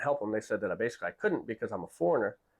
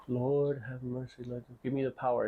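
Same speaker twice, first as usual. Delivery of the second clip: silent prayer; slower than usual. Retold or imitated. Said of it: "It's all I'm thinking".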